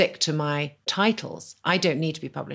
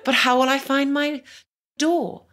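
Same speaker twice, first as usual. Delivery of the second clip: flustered naive guest; 9 semitones higher than usual. Retold or imitated. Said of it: "And I said".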